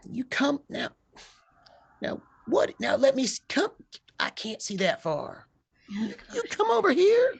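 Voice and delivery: put-on high, strained old woman's call. Half-blind Hattie calls the visitors closer, high-pitched. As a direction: squint and beckon them over. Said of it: "She goes".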